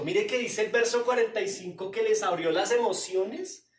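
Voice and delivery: loud preaching climax; fiery preaching. Acted. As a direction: hammer the thesis home. Preaching at full force.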